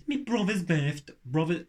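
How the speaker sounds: slow, tentative Brummie attempt; Brummie accent. Shown as a demonstration. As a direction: feel your way into a Birmingham accent. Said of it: "Birmingham has to be the worst one".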